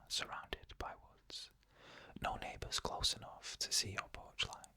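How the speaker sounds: ordinary delivery, nothing marked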